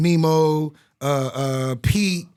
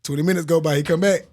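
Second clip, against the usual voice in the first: usual speaking voice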